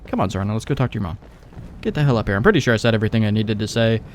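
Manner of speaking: normal talking voice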